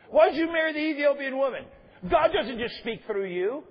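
mock Miriam hurling the charge; accusing question.

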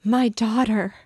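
high, loud, heartfelt cry; overwhelmed mother's outcry; cry from the soul. A narrator's reading. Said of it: "cried out in a voice which came from her inmost".

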